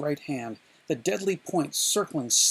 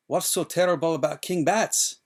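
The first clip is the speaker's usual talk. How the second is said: Inigo asking; 3 semitones higher, 5 dB louder than usual. A narrator's reading. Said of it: "so he started to say".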